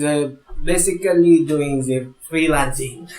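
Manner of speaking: lightly put-on French accent, laughing; a hint of French accent through a laugh. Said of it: "Do it in a French accent".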